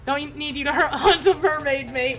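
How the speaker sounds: half-laughing NPC line, slowed; giggling as the gator hunter; laughing through the line. Acted. Hunter laughing while asking the player to fetch a mermaid.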